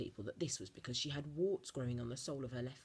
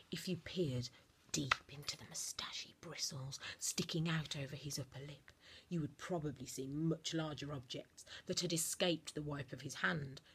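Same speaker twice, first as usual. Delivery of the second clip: usual speaking voice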